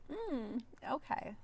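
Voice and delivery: ordinary talk, nothing marked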